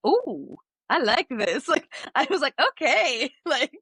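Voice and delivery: high, giggly, delighted; laughing delight. Retold or imitated. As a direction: squeal it out through a laugh.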